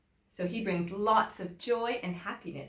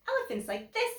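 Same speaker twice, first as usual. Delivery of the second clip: high pitched; faster than usual. A narrator's reading. Storybook contrast, pitch jump.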